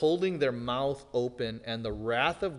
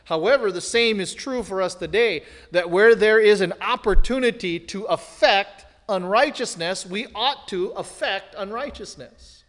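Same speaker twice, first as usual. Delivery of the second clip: much louder, higher pulpit exhortation; full-force preaching; 7 semitones higher, 10 dB louder than usual. Acted. Preacher urging the congregation to act against unrighteousness, thundering. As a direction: thunder the challenge at them.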